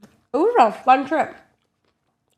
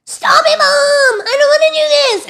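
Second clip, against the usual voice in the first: high, loud mock bawling; tantruming kid; 12 dB louder than usual. Older son fighting his mom, wailing tantrum. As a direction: wail like a child mid-meltdown. Imitates big kid's tantrum.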